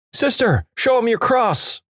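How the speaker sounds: very high-pitched nun calling out; mock yell. Nun yells to the second about a vampire, high mock yell. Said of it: "The first nun yells to the second".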